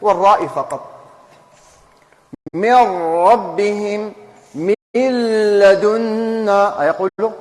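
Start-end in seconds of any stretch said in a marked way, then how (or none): 2.5-4.1 من ربهم said as "mirrabbihim", slow, nun merged into the ra; drawn-out "mirrabbihim" with no nasal; slow merged demo. Shown as a demonstration. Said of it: "تروح هي بالغنة". 4.5-6.8 من لدنا said as "milladuna", very slow with no nasal; slow merged demo. Shown as a demonstration. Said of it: "تروح هي بالغنة في اللام".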